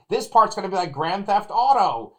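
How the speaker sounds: loud, high-pitched moviegoer narrating the film; imitating excited announcing. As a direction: mimic a fan calling out what's coming. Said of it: "kind of announce things when they were happening".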